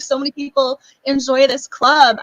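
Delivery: ordinary delivery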